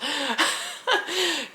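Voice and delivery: high, soft burst of laughter. Real, not acted. Tarot reader amused at getting too involved, high laugh. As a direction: crack up in a high giggle.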